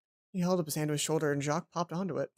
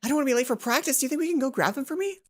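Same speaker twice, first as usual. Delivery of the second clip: higher, hurried roommate voice; anxious roommate; 6 semitones higher than usual. Acted. Roommate begging Hana to fetch her uniform, higher and anxious. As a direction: rush the favor, pitched up and worried.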